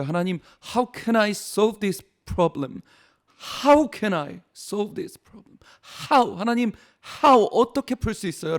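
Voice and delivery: a retold anguished prayer, stretched and rising; lamenting prayer. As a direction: draw out each word in a sighing plea. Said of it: "탄식하며 기도하고".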